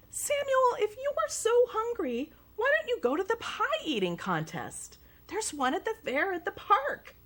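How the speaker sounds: put-on high little kid voice; helpful small brother; lightly voiced little brother.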